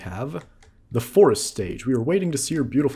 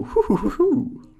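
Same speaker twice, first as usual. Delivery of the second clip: high, slow hooting laugh; slow high owl hoots; 4 dB louder, slower than usual. Acted. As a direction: hoot like a wise old owl.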